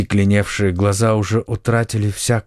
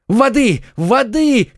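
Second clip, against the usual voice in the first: frantic high-pitched cries; desperate repeated cry for help; shouting for water; 13 semitones higher, 4 dB louder, slower than usual. A narrator's reading. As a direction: call out urgently, voice leaping up. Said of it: "кричал Д'Артаньян".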